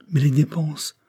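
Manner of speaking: plain reading voice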